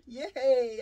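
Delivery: loud, bright whoop of joy; excited cheer. Real, not acted. Vlogger to viewers after blessings, joyful cheer.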